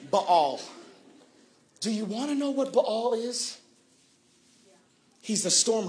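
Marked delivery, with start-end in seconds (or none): none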